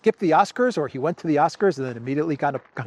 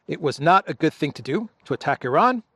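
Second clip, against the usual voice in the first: normal talking voice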